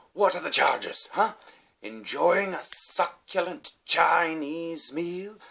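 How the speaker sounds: high, loud theatrical outrage, last words drawn out; imitated indignant yell. Arrested diner demanding the charges, mock grandiose yell. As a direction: declaim it hugely, savouring each slow word. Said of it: "and he's just yelling".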